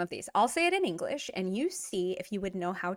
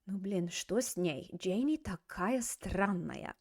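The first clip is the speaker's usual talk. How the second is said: whispered gossip, slower; gossipy whisper; 5 dB quieter, slower than usual. Acted.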